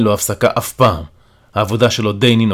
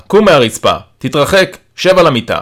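raised, firm commands; giving orders; 4 semitones higher, 4 dB louder than usual. A narrator's reading. Carer instructing the patient through the door, commanding. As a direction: order him off the floor. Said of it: "ומוסיף הוראה".

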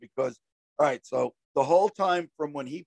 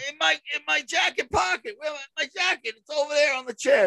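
high shouted answer; 10 semitones higher than usual. Retold or imitated. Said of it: "answering mother, yelling".

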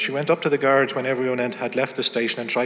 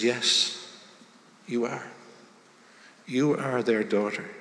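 soft, slow, reassuring; gentle reassurance; 6 dB quieter, slower than usual. A narrator's reading. Kind lady softly insisting Stephanie is their daughter, slow and tender. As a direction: press it home softly and slowly. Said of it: "gently took her by the hand".

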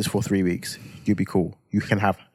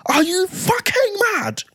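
losing it. Retold or imitated. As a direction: retell the row at full blast, much higher. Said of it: "I'm just losing it".

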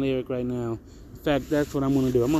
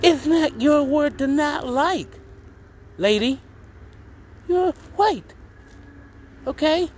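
indignant retort; 13 semitones higher, 3 dB louder than usual. Retold or imitated. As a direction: put the aunt in her place, high and loud.

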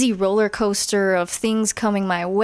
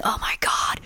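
breathy whispered self-criticism; hushed mock scolding; inner critic whisper; 4 dB quieter than usual. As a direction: hiss it to yourself. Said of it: "in my head, like, criticizing myself".

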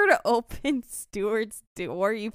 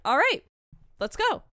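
all right, let's go, bright and higher, louder; upbeat all right; 7 semitones higher, 6 dB louder than usual. Shown as a demonstration. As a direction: chirp it to get going. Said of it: "No, after I said".

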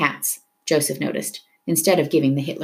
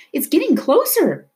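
high-pitched cry of alarm; frightened cry; 11 semitones higher, 3 dB louder than usual. A narrator's reading. Isabel looking up at the tanker, crying out. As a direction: cry out a fearful warning. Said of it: "Isabel cried".

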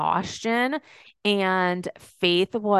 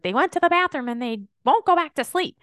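quick, higher mock complaint; frustrated husband; 7 semitones higher, faster than usual. Justin complaining about the puppies at night, mock exasperated. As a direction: rattle it off like an exasperated partner.

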